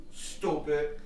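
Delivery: long oo in "Stupid"; mocking "Stupid"; stooopid. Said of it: "we used to say it like".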